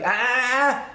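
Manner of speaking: high, staccato "ah-ah-ah" to cut someone off; mock scolding noise; interrupting "ah-ah-ah". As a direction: shut down questions with repeated ahs. Cutting off the questioners.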